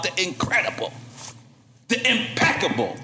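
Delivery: building to a roaring name; hype announcer. Acted. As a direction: announce him like a boxer. Announcing guest.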